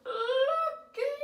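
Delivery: yelled high and loud; triumphant shout of the word. Acted. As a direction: belt the single word out. Repeating the word, then shouting it.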